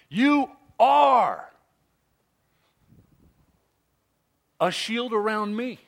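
"are" drawn out high and loud; stress on "are". Shown as a demonstration. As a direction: lean hard on "are", then pause. Corrects the wording to are.

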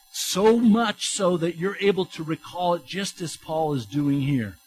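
ordinary talk

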